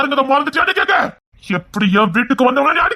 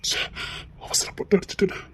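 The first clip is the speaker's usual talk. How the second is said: soft, lower, half-voiced regret; regretful murmur; 5 semitones lower, 10 dB quieter than usual. Husband quietly regretting he hit his wife. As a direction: mutter it to yourself.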